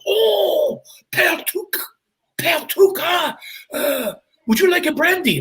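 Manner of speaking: put-on very high, delighted French-accent impersonation; French gentleman. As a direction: exclaim like an overjoyed Frenchman. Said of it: "French guy comes and goes".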